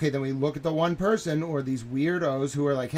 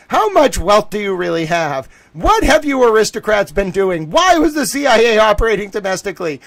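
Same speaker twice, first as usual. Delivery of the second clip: louder, higher, hammering each question; loud accusing questions; 5 semitones higher, 8 dB louder than usual. Retold or imitated. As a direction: demand answers, no letting up. Grilling donor on phone call.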